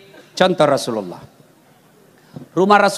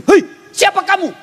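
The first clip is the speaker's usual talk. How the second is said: high startled call; startled cry; 11 semitones higher than usual. Acted. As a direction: jump back in fright. Husband startled by jinn.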